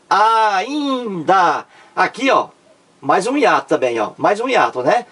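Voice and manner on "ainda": "Ainda" drawn out as Aaa-iiin-da; syllables stretched. Shown as a demonstration. Teacher showing students the hiatus, "Ainda" slowly stretched. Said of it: "É a sílaba tônica".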